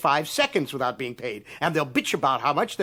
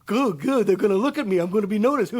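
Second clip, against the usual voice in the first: higher, naive mock voice; mocking eager amateur; 5 semitones higher than usual. Mocking amateurs eager to be noticed. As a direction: lampoon the eager amateur writer. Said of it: "They do it for nothing".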